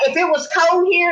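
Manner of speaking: normal talking voice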